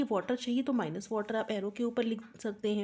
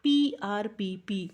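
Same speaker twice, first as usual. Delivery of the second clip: slow emphasis; 3 dB louder, much slower than usual. Shown as a demonstration. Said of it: "slow emphatic mnemonic".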